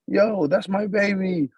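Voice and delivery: high, slow upset cry; distressed. Acted. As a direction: cry out in dismay. Mother cries out for baby.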